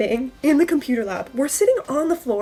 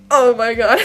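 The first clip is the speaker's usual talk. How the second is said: exasperated outburst; 9 dB louder than usual. Retold or imitated. Reacting to the whole class punishment.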